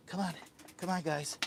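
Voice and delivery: ordinary talk, nothing marked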